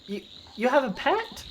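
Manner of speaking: put-on high, rising kid's question; curious child. Kid talking to the friendly goblin, childlike and curious. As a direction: ask it high, like an intrigued kid.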